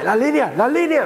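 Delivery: high frantic cry; going crazy. Retold or imitated. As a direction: cry it out like someone losing their mind. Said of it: "se vuelven locos de".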